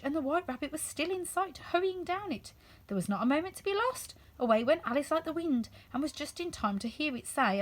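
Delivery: ordinary delivery, nothing marked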